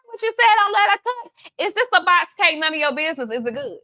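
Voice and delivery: hammed-up gossip asking if it's a box cake; nosy customer voice. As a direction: play the nosy customer in my shop. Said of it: "don't come up in my shop and be like".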